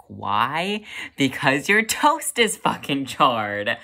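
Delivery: loud, slow, hammered-out exasperation; acted outrage. Cook finding the toast charred, loudly outraged. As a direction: punch out each word in indignation.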